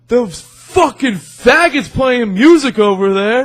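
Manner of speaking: put-on loud, high, drawn-out drunken shout; imitating a belligerent drunk; drunk guy yelling. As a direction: yell it across the bar like a drunk heckler. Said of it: "this one really, really drunk guy".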